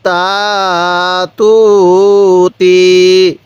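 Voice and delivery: very slow, each vowel stretched long; drawn-out syllables. Shown as a demonstration. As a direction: stretch তা তু তি out for a learner. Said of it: "কিভাবে শিখব?". (টেনে টেনে ধীরে উচ্চারণ)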